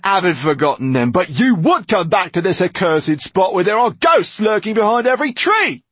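high, loud protest at full pitch; agitated outburst. Acted. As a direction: shout it out, rising to a peak on the last words. Frightened outburst at Mortola.

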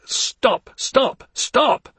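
high, urgent repeated shouts; shouting. Acted. Man shouting at the hysterical woman to stop. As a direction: shout her out of it. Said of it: "I shouted".

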